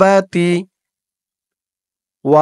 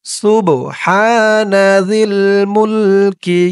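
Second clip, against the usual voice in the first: slow chanted সুবহানা জিল মুলকি; slow recitation; 3 semitones higher, much slower than usual. Shown as a demonstration. Teacher models the phrase for viewers to repeat, chanted. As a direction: recite it long and even for repeating. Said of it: "আমার সাথে বলুন".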